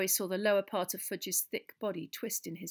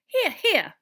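tiny high squeak, loud; piping, excitable little character voice; 13 semitones higher, 14 dB louder than usual. A narrator's reading. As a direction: squeak agreement like a tiny professor. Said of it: "squeaked tiny Professor Flitwick".

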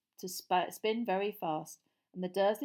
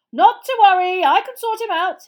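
high, very loud yell; 12 semitones higher, 19 dB louder than usual. A narrator's reading. Mister Weasley advancing on Dudley amid screaming, yelling. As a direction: shout over the din to be heard. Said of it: "he yelled".

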